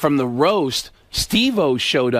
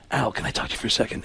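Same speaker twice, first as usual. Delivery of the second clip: hushed, quick, softer Baldwin; Alec Baldwin impression; 6 semitones lower, 8 dB quieter, faster than usual. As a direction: lean in and ask quietly. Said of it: "Alec goes".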